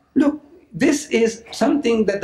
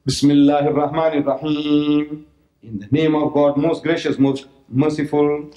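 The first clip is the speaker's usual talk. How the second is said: reverent recitation; 4 semitones lower than usual. Acted. Recites the Quranic invocation.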